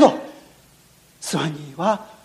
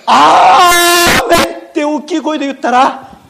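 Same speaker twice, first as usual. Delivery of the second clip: loud; 4 semitones higher, 6 dB louder, slower than usual. A narrator's reading.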